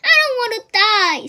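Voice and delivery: high, very loud desperate scream. A narrator's reading. Wilbur throwing himself to the ground, screaming. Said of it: "screamed Wilbur".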